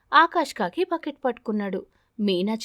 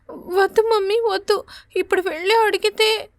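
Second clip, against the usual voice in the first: put-on high, tearful child voice begging; pleading little girl; 10 semitones higher, 4 dB louder than usual. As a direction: beg mother not to go, near tears. Crying child begs. (ఏడుస్తూ వేడుకునే చిన్నారి గొంతు)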